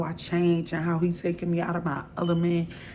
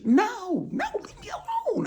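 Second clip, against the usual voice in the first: much higher, sharp no to strangers on the street; angry brush-off; 4 dB louder than usual. Retold or imitated. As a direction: push them away, angry and on edge. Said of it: "I'm angry".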